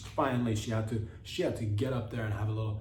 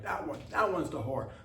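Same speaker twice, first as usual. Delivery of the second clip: mock accuser voice; 3 semitones higher than usual. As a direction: point and blurt it out as a mock accusation. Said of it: "you're like".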